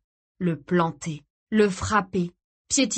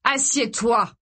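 firm, raised command; ordering; 4 semitones higher, 4 dB louder, slower than usual. A narrator's reading. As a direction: give a curt order, pitched up. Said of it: "lui ordonna-t-il". (ordre sec)

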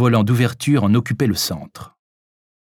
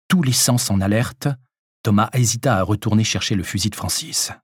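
usual speaking voice

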